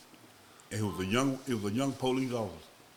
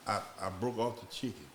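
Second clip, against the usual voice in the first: ordinary talk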